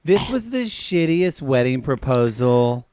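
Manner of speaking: loud mock outrage. Real, not acted. Co-host judging the acted scene, loud and high. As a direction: proclaim it in exaggerated dismay. Out-of-character verdict.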